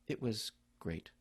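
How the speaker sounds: low, soft and slow, dejected; glum. Retold or imitated. That's got one meaning.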